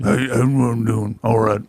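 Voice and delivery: put-on gritty old-timer voice. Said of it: "like a Wilford Brimley".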